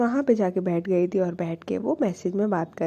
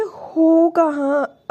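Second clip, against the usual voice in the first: drawn-out, higher, mock-annoyed demand; retold annoyance; 6 semitones higher, 4 dB louder, much slower than usual. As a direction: act out an impatient text, stretched slowly. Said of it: "आप क्यों गुस्सा कर रहे हो".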